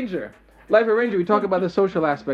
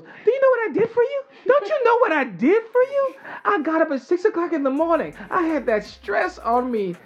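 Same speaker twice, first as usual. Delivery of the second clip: much higher, hammed-up guilt-tripping mother; dramatic mother; 10 semitones higher than usual. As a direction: play the martyred mom, milking every sacrifice. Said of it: "You're like that mother who goes".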